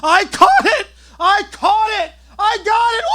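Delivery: high, loud victory yell; triumphant yelling. Retold or imitated. Narrator yelling his catch to the house. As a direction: scream it like you just landed a whopper catfish. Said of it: "I start yelling and jumping around".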